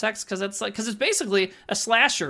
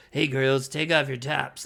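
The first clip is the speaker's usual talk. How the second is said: Walter impression; 6 semitones lower than usual. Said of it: "talks exactly like Walter".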